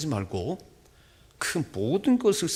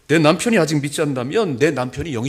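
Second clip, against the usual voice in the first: loud preaching; 8 dB louder than usual. Acted. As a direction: plead with the congregation at full force. Preaching climax, loud plea.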